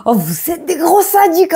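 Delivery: high laughing outburst; squealing laugh at the viewers. Real, not acted. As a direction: laugh through the words, high. Laughing at sadistic commenters.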